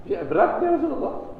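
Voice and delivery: high, whiny plea that it is too heavy; Bilal's complaint. Retold or imitated. Bilal complaining to the Prophet, high mock whine. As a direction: pitch it up like a pleading companion. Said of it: "Dia bilang".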